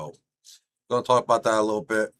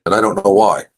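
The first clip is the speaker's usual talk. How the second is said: echoing; 13 dB louder than usual. Real, not acted. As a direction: voice echoed back, delayed. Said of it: "I'm fucking echoing".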